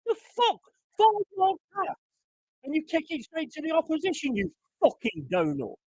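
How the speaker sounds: can't believe the pass; exasperated fan; incredulous rant. Real, not acted. Fan ranting at bad pass.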